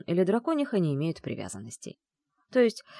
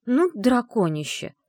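higher, louder, slow mock threat; mock indignant threat; 7 semitones higher, 5 dB louder than usual. Bride scolding her dragon groom, mock threat. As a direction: scold him half-playfully.

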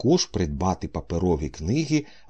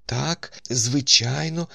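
higher-pitched, searching agreement; feverishly pondering; 7 semitones higher, slower than usual. A narrator's reading. Doctor searching his memory for a story, mildly frantic. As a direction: say yes while racking your memory. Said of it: "усе ще гарячково міркуючи".

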